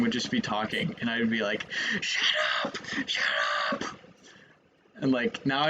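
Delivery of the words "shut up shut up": very high, strained mock scream; mock angry yell. Streamer recalling childhood anger at YouTubers, mock angry yell. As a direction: imitate a kid yelling at the video. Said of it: "I would get so angry".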